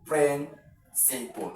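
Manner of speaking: "Principal" drawn out very slowly, stress on the first syllable; slow stressed demo. Shown as a demonstration. Teacher slowly models the word for exam students. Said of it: "Three syllable word here".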